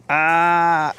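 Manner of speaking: usual speaking voice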